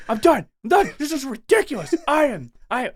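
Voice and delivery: high and loud, exasperated; fed-up yell. Retold or imitated. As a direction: snap like you need to quit. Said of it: "And you're like".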